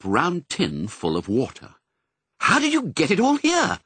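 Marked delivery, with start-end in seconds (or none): 2.4-3.8 high astonished question. A narrator's reading. Pongo amazed by the breakfast, asks in high astonishment. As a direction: Pongo amazed at the food, voice jumping up. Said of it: "asked Pongo, astonished".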